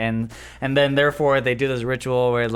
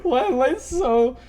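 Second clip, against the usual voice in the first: laughing. Real, not acted. Laughing fit mid-story.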